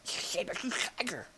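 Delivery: hushed whisper; tense whisper. Real, not acted. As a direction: keep it down so it won't hear. Hiding from a predator.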